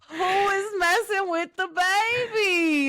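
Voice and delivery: high, slow, coddling voice; mock baby talk. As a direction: coo at her like a hurt baby. Comforting her upset friend.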